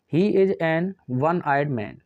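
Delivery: "an one" read slowly and evenly as in the question; slow dictation. Shown as a demonstration. Teacher reading out the question sentence, slow and deliberate. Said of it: "वन".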